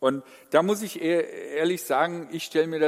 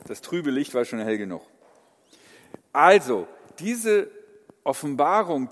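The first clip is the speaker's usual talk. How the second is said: ordinary delivery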